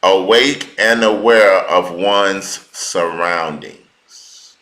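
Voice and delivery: drawn-out, deliberate reading of a definition; spaced-out, measured words; slow dictation. Real, not acted. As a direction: read slowly so listeners can get it. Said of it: "I'ma say it slow so you can get it".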